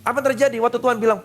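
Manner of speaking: normal talking voice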